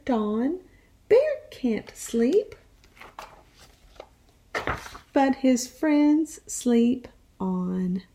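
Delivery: put-on lively read-aloud voice for young children; animated picture-book reading; storytime narration. A narrator's reading.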